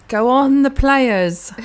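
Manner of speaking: loud, high pretend cheering; mock cheerleader. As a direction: cheer on a team you know nothing about. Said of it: "I'm the best cheerleader".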